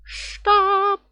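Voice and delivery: ordinary talk, nothing marked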